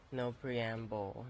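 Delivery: ordinary talk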